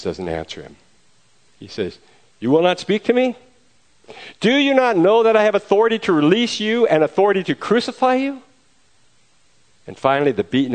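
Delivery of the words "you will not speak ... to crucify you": high, loud, affronted questioning; indignant demand. Acted. Pilate confronts the silent prisoner, high loud indignation. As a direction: demand an answer from someone who defies you.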